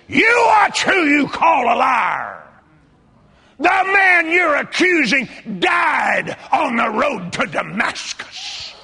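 high, booming reprimand; thundering, high-pitched scolding shout; hollered rebuke. Retold or imitated. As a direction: holler it as a stern divine warning. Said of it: "he hollered at me and said".

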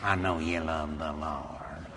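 low, soft tempter's voice; hushed, deep devil coaxing; insinuating devil. Acted. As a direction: sidle up quietly, very low. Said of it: "the devil starts pushing you around".

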